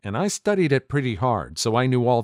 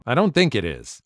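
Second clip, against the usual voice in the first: ordinary delivery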